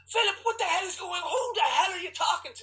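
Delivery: high shouting mother's voice; mom belting out. Retold or imitated. Mom yelling at son mowing the lawn, high shout. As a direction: yell it like a startled mom out the window. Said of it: "My mom just belts out".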